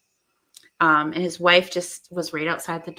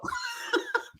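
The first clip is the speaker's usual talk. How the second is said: laugh; 7 dB quieter than usual. Real, not acted. Laughs at own point.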